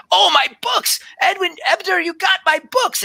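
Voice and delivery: very high, breathless delight; excited tabaxi druid. Acted. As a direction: gush like a wide-eyed scholar. Druid grabs her books.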